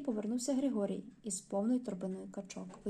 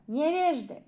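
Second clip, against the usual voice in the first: high, loud outraged cry; booed performer shouting at the audience from a bench; furious shout; 6 semitones higher, 7 dB louder, slower than usual. Acted. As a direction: hurl the insult at the crowd. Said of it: "Тип вскочив на лаву і закричав".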